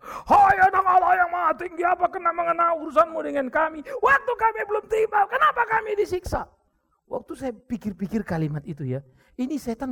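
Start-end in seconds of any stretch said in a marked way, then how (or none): 0.0-6.5 high, loud demon's shriek. Acted. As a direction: cry out like a tormented demon. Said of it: "setannya bilang".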